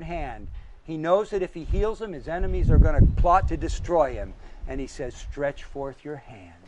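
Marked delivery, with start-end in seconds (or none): 5.1-6.5 soft, slow, calm command; quiet command. Acted. Jesus telling the man with the withered hand, softly. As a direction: say it low and unhurried, almost hushed.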